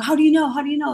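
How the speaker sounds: high, urgent repeated question; frantic disbelief. Retold or imitated. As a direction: demand an answer in shock. Frantic question on hearing of death.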